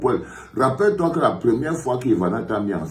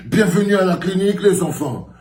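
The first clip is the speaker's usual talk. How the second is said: silly clown; 8 semitones higher, 4 dB louder than usual. Pretend. Clown host welcome, high.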